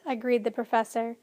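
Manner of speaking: usual speaking voice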